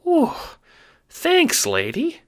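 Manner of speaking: squeaky wiseguy, lightly out of breath; huffing thanks. Acted. Rescued little guard thanks the Seer, huffing. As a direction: gasp out thanks after the fall. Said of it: "he says, huffing".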